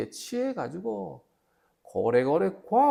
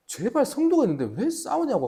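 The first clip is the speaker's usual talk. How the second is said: higher-pitched, mock-exasperated scolding; retold annoyed plea; 8 semitones higher than usual. Pastor scolding noisy church members next door, retold annoyance. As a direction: act out a fed-up plea to stop the noise. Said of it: "화가 나가지고".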